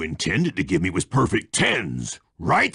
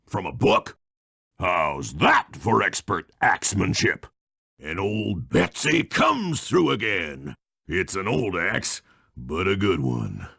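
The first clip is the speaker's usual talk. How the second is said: ordinary talk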